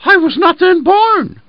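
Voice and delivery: very high, loud bleat of a mournful lamb. Acted. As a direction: bleat it out sadly. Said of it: "bleated the lamb in a mournful tone of voice".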